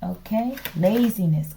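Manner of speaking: ordinary talk, nothing marked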